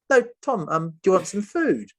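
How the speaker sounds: high, insistent repeat of the offer; insistent captor. Retold or imitated. Captor insisting Tom stay for food, high and pushy.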